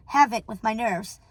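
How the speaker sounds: ordinary delivery, nothing marked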